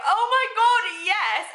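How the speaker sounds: high, loud ecstatic yes; retold screaming. Speaker accepts own radio show, high retold squeal. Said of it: "I was screaming".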